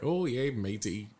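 slow, soft hint of a pirate. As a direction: toss off a lightly voiced pirate. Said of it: "Sound like a pirate".

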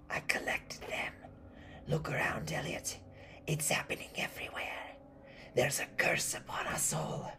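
eerie, whispered rasp; raspy old woman. Acted. Old woman warning Elliot of the curse, whispering raspily. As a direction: whisper the warning almost without voice.

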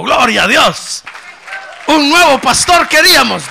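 loud, high cry of praise; a crowd hailing their new pastor, loud and glad; joyful crowd shout. Acted. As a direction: cheer it like a happy congregation. The people cheering a new pastor.

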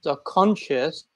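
ordinary talk

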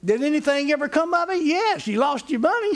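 high-pitched, tipping into a big laugh; laughing punchline. Real, not acted. Preacher jokes about the wishing well, laughing. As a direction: hit the punchline as laughter breaks through.

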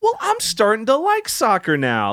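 touched. Real, not acted. Sudden sweet reaction to headline.

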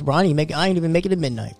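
usual speaking voice

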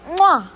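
a loud smacking mwah, high and proud; chef's kiss. Real, not acted. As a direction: kiss the air like a chef. Kisses her title idea.

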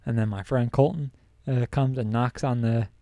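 normal talking voice

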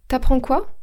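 „T'apprends” as one word, u dropped; wymowa potoczna. Shown as a demonstration. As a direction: run t straight into „apprends”, rising on „quoi”. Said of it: "à l'oral, on dit".

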